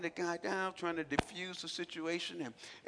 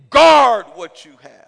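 much louder, slow and heavy; booming slow command; 25 dB louder, slower than usual. Real, not acted. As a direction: roar it as an order. Preaching exhortation.

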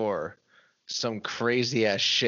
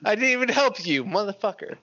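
laughing protest, higher; 9 semitones higher, slower than usual. Real, not acted. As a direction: laugh through it, indignant.

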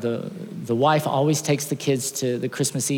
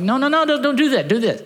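much higher, louder urgent no no no; high, loud alarmed pleading; frantic pleading; 11 semitones higher, 4 dB louder than usual. Retold or imitated. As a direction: plead with the bird to stop. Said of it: "And he's like".

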